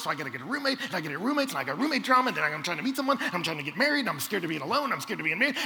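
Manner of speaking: rapid-fire worried rant, building in volume; mounting panic. Acted. Anxious student unloading worries on the preacher, panicky. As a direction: rattle off the worries without stopping. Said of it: "you start to feel this panic".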